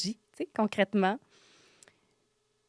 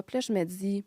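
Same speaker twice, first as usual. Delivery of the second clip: usual speaking voice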